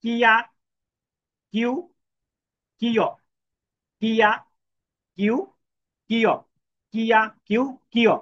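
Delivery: teacher reading কিয়া কিউ কিয় aloud for students to repeat; clear syllable drill. Shown as a demonstration. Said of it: "তাহলে কিভাবে পড়বো আমরা?".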